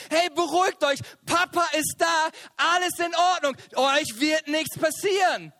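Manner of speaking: calling over screams. Retold or imitated. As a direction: shout comfort over the ride noise. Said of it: "Und ich so".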